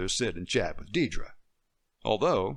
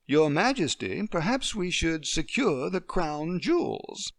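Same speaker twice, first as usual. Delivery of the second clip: light mock British accent, slow and courtly; playful British accent; 7 semitones higher than usual. Deirdre playing a British courtier for Emily. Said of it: "in her best British accent".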